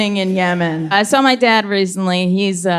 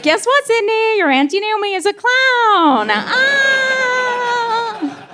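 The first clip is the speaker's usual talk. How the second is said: put-on much higher, shrieking sing-song to a toddler; overexcited parent; 14 semitones higher, slower than usual. Parent to toddler, shrill forced excitement. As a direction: squeal it like a frazzled parent performing joy. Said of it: "They're like".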